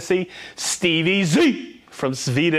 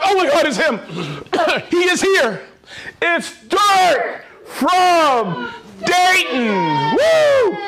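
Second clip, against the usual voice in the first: higher and louder, shouting with delight; excited; 8 semitones higher, 3 dB louder, slower than usual. Real, not acted. Host spotting a favorite viewer in chat, thrilled shouting.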